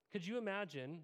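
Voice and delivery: ordinary delivery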